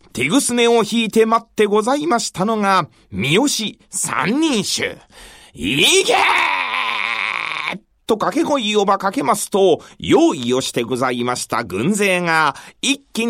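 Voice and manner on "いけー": drawn-out charge shout; battle cry. Acted. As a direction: yell the attack order. Battle cry launching the attack.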